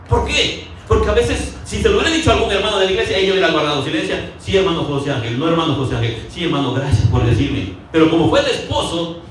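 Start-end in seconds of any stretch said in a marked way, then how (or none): 4.4-7.8 lower, docile yes-sir voice; meek agreement. Retold or imitated. Wife answering a church brother, lower and meekly agreeable. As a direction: play the obedient church sister.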